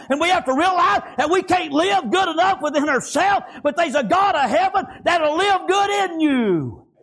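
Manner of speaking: high, driving sermon cadence; impassioned preaching. Real, not acted. Preacher warning congregation about weak flesh, high impassioned preaching.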